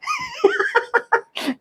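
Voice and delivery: very high-pitched squealing giggle; long falsetto giggle; high playful laugh. Real, not acted. Playing with the child.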